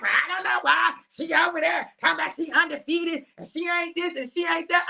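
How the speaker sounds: high, shouted mock complaint; imitating a jealous hater. Mimicking people mad at her success, high mock shout. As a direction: squawk it like a jealous gossip. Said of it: "You gonna be like".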